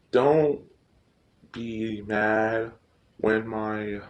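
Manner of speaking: usual speaking voice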